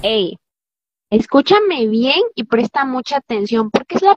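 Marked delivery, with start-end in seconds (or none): none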